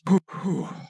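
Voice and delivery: long blown-out sigh; sigh of relief. Retold or imitated. Man retelling his relief in the bathroom, sighing it out. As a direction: re-enact a relieved exhale. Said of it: "And I just have this".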